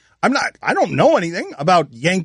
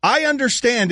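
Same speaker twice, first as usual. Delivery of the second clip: imitating; 8 semitones higher than usual. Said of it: "is your jab".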